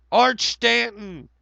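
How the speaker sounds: high, slow, loud call of the name; excited shout-out. Real, not acted. Streamer greeting a chatter, loud and high. Calling out a username.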